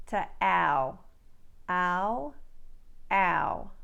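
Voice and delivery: broad Australian ow, repeated. Shown as a demonstration. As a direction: widen ow with corners spread. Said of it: "widen the corners of the mouth".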